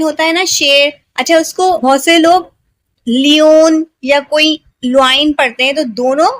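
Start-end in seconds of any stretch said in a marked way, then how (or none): 3.1-3.9 लियोन said as "lee-OWN"; demonstrating the mistaken "lee-on" reading लियोन; mispronounced lion. Shown as a demonstration. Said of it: "बिल्कुल गलत है". 4.8-5.3 लुआइन said as "loo-ain"; demonstrating the mistaken "lu-ain" reading लुआइन; mispronounced lion. Shown as a demonstration. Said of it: "बिल्कुल गलत है".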